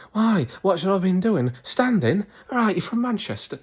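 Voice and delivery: high-pitched mock Manchester accent; attempted Manchester accent. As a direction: put on a high, cheeky Manchester voice. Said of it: "was that a Manny accent?".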